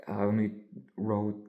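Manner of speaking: ordinary delivery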